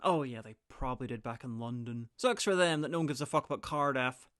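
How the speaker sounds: ordinary talk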